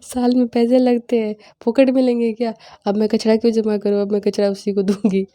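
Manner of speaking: high, loud mock of mom's indignant reasoning; hammed-up mom laying down the law; imitating mom. Mom explaining why the waste must go to the collector, mock indignant. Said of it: "बोलती है".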